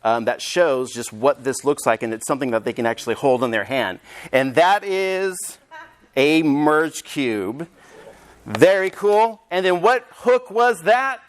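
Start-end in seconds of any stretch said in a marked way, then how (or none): 4.3-7.7 slow reveal. Shown as a demonstration. Teacher's dramatic slow reveal.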